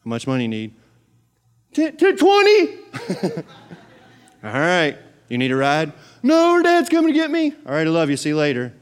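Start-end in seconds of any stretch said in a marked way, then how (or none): 1.7-2.8 put-on high child voice; squeaky kid voice; kid asking for cash. Kid asking dad for money. 6.2-7.5 put-on higher kid voice; a hint of a kid's voice; lightly voiced kid. Kid answering mom.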